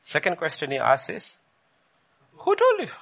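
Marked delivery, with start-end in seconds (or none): 2.4-2.9 high, sharp questioning voice of God. Acted. God demanding of Adam, high and piercing.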